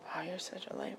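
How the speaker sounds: teasing whisper. Real, not acted. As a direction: whisper it playfully.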